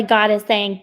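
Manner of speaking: normal talking voice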